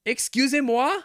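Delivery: high, outraged French "excuse me"; mock offended. Real, not acted. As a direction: clutch your pearls in French. Shocked reaction in French.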